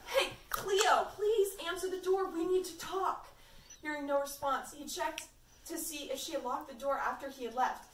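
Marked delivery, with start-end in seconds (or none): 0.1-3.3 raised, higher-pitched urgent call; shouting. A narrator's reading. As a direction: plead through the door, half shouting. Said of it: "he shouted".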